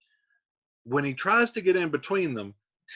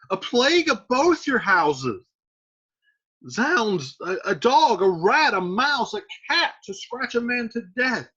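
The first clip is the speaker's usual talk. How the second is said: wounded Mercutio's speech; 6 semitones higher, 5 dB louder than usual. Acted. Dying Mercutio's speech read.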